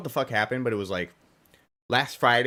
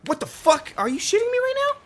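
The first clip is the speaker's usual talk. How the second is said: shrieking much higher and louder in disbelief; real outraged yell; 6 dB louder than usual. Real, not acted. Streamer raging at the racing game, outraged yell. Reacting to game mid-race.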